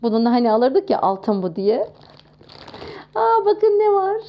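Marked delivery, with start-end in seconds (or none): 3.1-4.2 delighted surprise. Real, not acted. Spots a toy in box.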